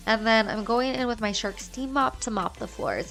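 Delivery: normal talking voice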